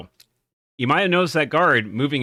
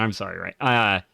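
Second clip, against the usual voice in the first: ordinary talk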